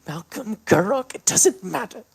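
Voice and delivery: loud, high rising fury; furious. Acted. Davine refusing to be fooled, shouting with rising rage. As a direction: build to a snarl.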